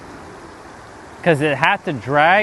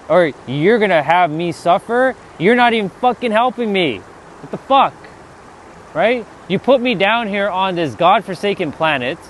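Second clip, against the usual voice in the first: raised, indignant rant, pitch pushed higher; ranting at God; 3 semitones higher than usual. Retold or imitated. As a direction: vent at God like he cheated you. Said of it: "I would be talking like this".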